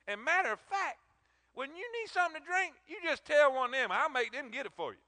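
high, loud, excited reassurance; eager Boaz. Acted. Boaz promising Ruth protection in his field, high and loud. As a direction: promise her she's safe, all fired up.

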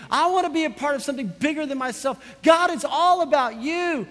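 preaching that builds to a high, loud climax; full-hearted rallying sermon voice; impassioned preaching. Real, not acted.

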